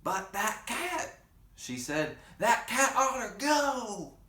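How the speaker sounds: put-on high, slow old-lady grumble; high slow granny voice. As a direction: drag it out like a fussy granny. Granny voiced, complaining about cat.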